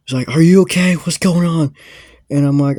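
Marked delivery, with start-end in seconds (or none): 0.3-1.7 imitated high, loud demand; alarmed dad. Dad busting in on the two friends, alarmed. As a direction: act out bursting in alarmed. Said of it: "his dad busts in the door".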